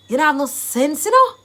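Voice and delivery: acted exasperated telling-off; scolding. Shown as a demonstration. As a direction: scold them with a sharp rising tag. Acting out insulting someone's sense.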